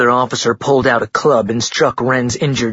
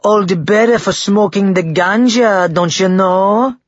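loud, mocking fake Jamaican; fake Jamaican accent; 9 semitones higher, 5 dB louder than usual. A narrator's reading. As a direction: stretch it out in a hammed-up Jamaican accent. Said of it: "answered in a fake Jamaican accent".

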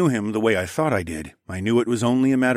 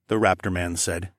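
ordinary delivery, nothing marked

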